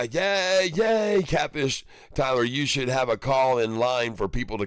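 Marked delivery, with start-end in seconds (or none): 0.0-1.8 cheering. Real, not acted. Cheering a viewer.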